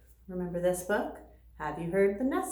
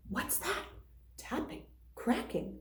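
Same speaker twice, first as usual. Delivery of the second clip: hushed suspenseful whisper; soft, breathy hush; 5 semitones higher, 8 dB quieter than usual. A narrator's reading. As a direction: whisper as the egg cracks. Egg hatching, hushed.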